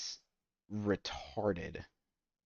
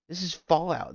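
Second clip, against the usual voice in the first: indignant outburst; 9 semitones higher, 6 dB louder than usual. Real, not acted. Ranting about show ripping off Marvel.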